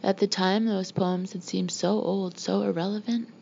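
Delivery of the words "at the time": ordinary delivery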